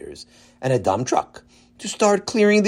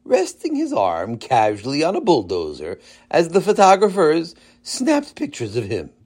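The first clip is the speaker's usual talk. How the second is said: ordinary delivery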